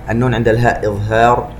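usual speaking voice

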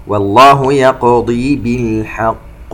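slow chanted tajweed recitation with echoing qāf; Quran recitation. Shown as a demonstration. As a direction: recite slowly, bouncing the qāf. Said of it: "نقلقل القاف". (تلاوة مرتلة ببطء مع قلقلة القاف)

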